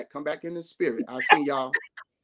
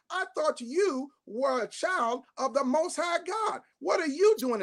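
rising preacher shout; 8 semitones higher than usual. Acted.

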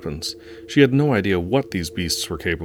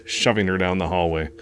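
ordinary delivery

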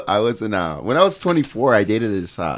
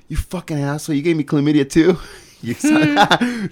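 raised, half-mock yell at his ex; retold yell; 7 semitones higher, 3 dB louder than usual. Man yelling at his cheating ex, a loud mock yell. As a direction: shout the accusation across the room. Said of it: "I'm yelling right next to her".

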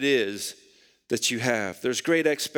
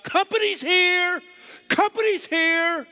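high, drawn-out mock scream; imitated scream; 4 dB louder than usual. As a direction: holler it like a trash-talking ballplayer after a basket. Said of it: "he'd scream out".

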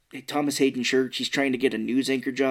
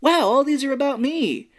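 high-pitched, delighted amazement; big starry-eyed wow, pitched way up; 13 semitones higher, 4 dB louder than usual. Acted. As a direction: marvel out loud at seeing yourself everywhere. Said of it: "The real reaction that you would normally have".

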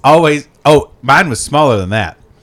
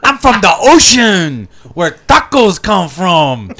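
much higher, wild party-guy yell; rowdy party animal; 12 semitones higher than usual. Pretend. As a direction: whoop it up like a crazed party dude. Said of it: "My name is Captain Crook".

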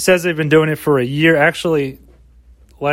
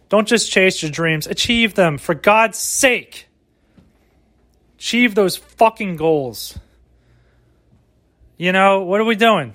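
slow, emphatic hammed-up pep talk; mock motivational speech; 4 semitones higher, slower than usual. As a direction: preach it like a cheesy life coach.

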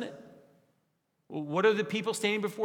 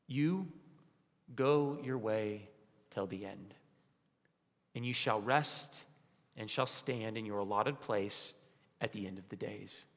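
solemn angel; 7 semitones lower, 6 dB quieter than usual. Retold or imitated. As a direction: pronounce it gently like a final blessing. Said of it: "the angel says this".

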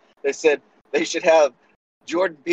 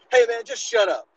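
imitating Biden; 9 semitones higher than usual. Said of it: "the guy's just been talking like me and you".

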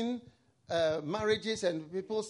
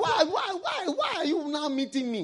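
high, repeated imploring whys; retold plea; 8 semitones higher than usual. People to preacher on meeting late, acted high plea. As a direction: act out a pleading question, pitched up. Acts out people's regretful question.

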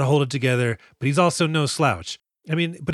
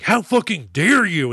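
slow, higher-pitched affronted outburst; drawn-out mock outrage; mock indignation; 6 semitones higher, 4 dB louder than usual. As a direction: play the offended prima donna. Acted samurai storms off offended.

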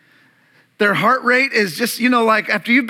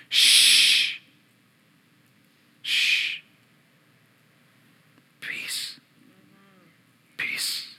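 whispered shh, then peace, fading to nothing; hushing whisper; 5 dB quieter, much slower than usual. Retold or imitated. Jesus calming the storm, whispered hush. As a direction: hush them to sleep, softly.

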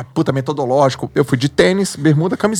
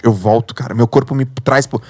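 normal talking voice

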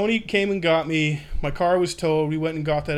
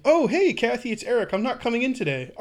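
higher, breezy call-in-sick voice; fake-casual phone call; 7 semitones higher than usual. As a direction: act like nothing is wrong, brightly. Calling his supervisor from jail.

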